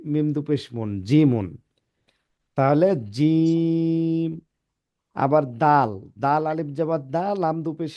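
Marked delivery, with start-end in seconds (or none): 2.6-4.3 জিম drawn out slowly; slow recited জিম; three-alif prolongation. Shown as a demonstration. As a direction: hold the vowel in জিম for three counts. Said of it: "যে হরফগুলো তিন আলিফ টেনে পড়তে হয়".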